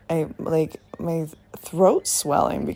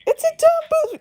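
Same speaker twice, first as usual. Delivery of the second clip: squeaky outburst; much faster than usual. Real, not acted. Defending movie with mock outrage.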